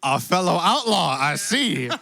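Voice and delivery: folksy cowboy drawl, lightly put on; cowboy accent. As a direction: play a genial Western cowboy host. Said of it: "Is your accent supposed to be".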